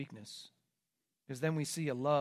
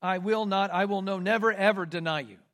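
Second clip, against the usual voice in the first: louder, stressing never and ever; strongest denial; 5 dB louder than usual. Shown as a demonstration. Preacher rendering Peter's Greek, emphatic denial. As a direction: hammer each no and never. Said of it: "no stronger way to say it".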